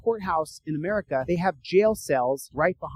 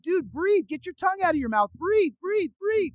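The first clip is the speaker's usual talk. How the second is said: high, urgent pleading; acted panicky command; urgent coaxing; 12 semitones higher than usual. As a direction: urge a choking man on, pitch climbing. Urging a choking man to breathe.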